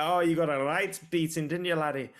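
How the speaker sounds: mock Scottish teasing, like a mate gloating; Scottish accent. Mate gloating at Conor in hospital in a Scottish accent. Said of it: "Don't know why I went Scottish there".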